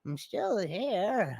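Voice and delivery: character voice. Acted. Voice actor doing character voice.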